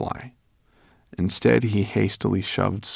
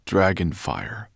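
usual speaking voice

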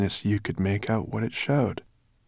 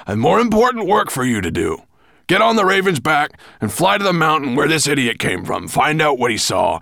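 loud, raised, commanding master; angry orders; 9 semitones higher, 9 dB louder than usual. A narrator's reading. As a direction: bark orders at your servant.

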